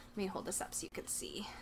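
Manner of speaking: soft unvoiced whisper; whispered aside while lifting the tin. Real, not acted. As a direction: murmur it under your breath. Aside while holding item.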